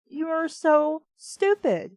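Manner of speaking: ordinary delivery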